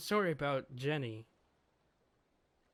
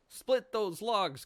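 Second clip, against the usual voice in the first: bossy order; 5 semitones higher, 4 dB louder, slower than usual. Acted. Jenny bossing Kuma, read aloud.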